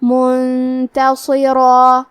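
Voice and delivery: slow chant, منتصرا ending on a thick ra and long alif; heavy ra. Shown as a demonstration. Teacher shows tafkhim, chanting منتصرا with heavy long ending. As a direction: end منتصرا on a full, heavy, held "raa". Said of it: "بھاری آواز میں پڑھنا ہے".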